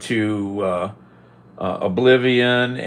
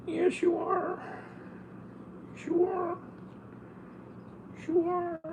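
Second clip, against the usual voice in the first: very high, soft cooing; 10 dB quieter than usual. Real, not acted. Cooing at the purring cat, very high and soft. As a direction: melt over the cat.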